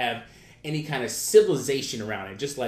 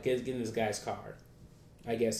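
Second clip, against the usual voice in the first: ordinary talk, nothing marked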